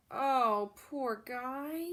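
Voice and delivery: plain reading voice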